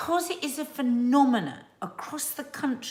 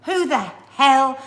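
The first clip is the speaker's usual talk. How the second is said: slow, louder, incredulous; exasperated; 8 dB louder, much slower than usual. Real, not acted. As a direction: demand to know, baffled. Exasperated question.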